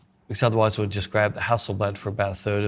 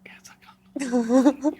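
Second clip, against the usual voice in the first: slow voiceless whisper, pitched up; lost voice; 14 semitones higher, much slower than usual. Retold or imitated. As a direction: act out a voice that's gone, slowly. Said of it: "when you lost your voice".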